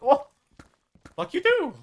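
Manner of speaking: high, loud playful retort; squeaky-high acted comeback; mock indignant comeback. Retold exchange of swearing.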